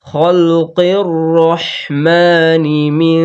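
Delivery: slow chanted recitation of খলকির Rahmani মিন; Quran recitation. Shown as a demonstration. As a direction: draw the vowels out in a recitation chant. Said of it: "এইভাবে উচ্চারণ করতে হবে".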